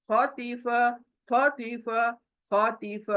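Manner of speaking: ordinary talk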